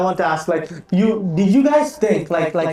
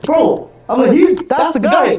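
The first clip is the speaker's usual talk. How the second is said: high, loud laughing disbelief; disbelieving laugh; 6 semitones higher, 4 dB louder, slower than usual. Retold or imitated. Friend reacts to his aunts, high and laughing. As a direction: laugh it out in shock.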